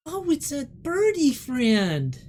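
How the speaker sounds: put-on high, slow, delighted child voice; slow, high-pitched little girl. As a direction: coo loudly at a new bird friend. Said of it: "she looks up and goes".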